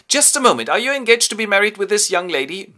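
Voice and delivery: put-on high, loud and fast indignant girl; hammed-up high-pitched outrage; mock indignant young lady. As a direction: fire it off like a huffy debutante. Gwendolen confronts her rival.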